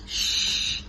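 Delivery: breathy unvoiced shush; baby's shush. Baby hushing the others, whispered shush. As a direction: hush everyone like a tiny baby.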